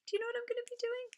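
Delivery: bright teasing high-pitched question; excited high question. Real, not acted. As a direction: rise way up, quick and eager. Repeats question, even higher.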